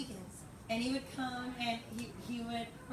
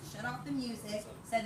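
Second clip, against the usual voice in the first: ordinary talk, nothing marked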